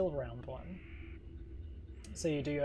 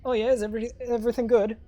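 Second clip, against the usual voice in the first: a lightly voiced dwarf, cheerful, louder; dwarf on the radio; 6 semitones higher, 9 dB louder, faster than usual. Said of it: "the dwarf turns around".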